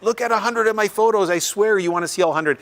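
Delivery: pushy self-quote. Retold or imitated. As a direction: act out begging people to see all your photos. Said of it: "I'd be like".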